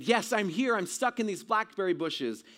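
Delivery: loud, high answering call. Retold or imitated. Trapped boy answering a voice through the bushes, calling out. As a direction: call back urgently to someone out of sight.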